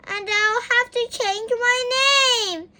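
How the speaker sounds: put-on a little girl crying; sobbing. A narrator's reading. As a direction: sob it out through tears. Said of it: "she sobbed".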